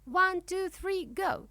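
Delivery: high-pitched starting call; cried-out countdown. A narrator's reading. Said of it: "she cries".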